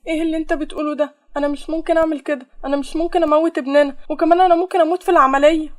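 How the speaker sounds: upset protest. Acted. Acted quarrel, refusing his demand. (اعتراض منفعل بصوت عالٍ)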